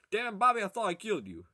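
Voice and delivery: loud, high cartoon dad impression; hammed-up startled scolding; King of the Hill. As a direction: cry out like an exasperated cartoon father. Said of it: "King of the Hill, damn it, Bobby guy".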